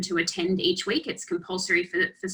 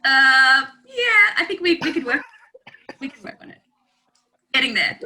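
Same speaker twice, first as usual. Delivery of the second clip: laughing; 7 semitones higher, 7 dB louder than usual. Real, not acted. Teasing reply to bad accent.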